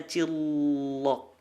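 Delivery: "cilok" with a very long dark l; thick l. Shown as a demonstration. Teacher using Bandung snack to drill lam, long-l "cilok". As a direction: dwell slowly on the l of "cilok". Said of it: "Bukan cilok".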